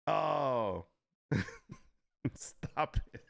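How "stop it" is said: normal talking voice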